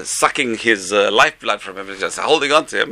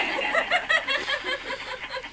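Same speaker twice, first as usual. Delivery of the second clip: laughing; 10 dB quieter than usual. Real, not acted. Laughs after acting out students.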